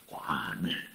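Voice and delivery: puzzled whisper under the breath; whispered aside. Retold or imitated. Preacher retells his doubt at God's order, whispered aside. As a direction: drop right down to a private whisper.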